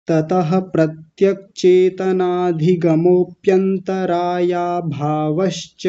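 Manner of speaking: sutra drawn out slowly with no gap; one slow unbroken line; slow continuous recitation. Shown as a demonstration. Teacher recites the whole sutra, slow and unbroken. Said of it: "अब पूरे को देखिए".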